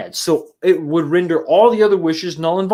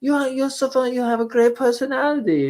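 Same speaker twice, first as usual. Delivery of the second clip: mock Gal Gadot accent, pitched higher; 7 semitones higher than usual. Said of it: "Gal Gadot's like".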